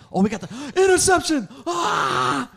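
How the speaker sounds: high, loud fan scream; elated shout. Retold or imitated. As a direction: explode as if the play just happened. Re-enacting joy at interception.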